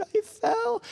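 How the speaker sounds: put-on high, small wailing kid voice; crying child. Hurt son telling dad what happened, crying. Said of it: "just started crying".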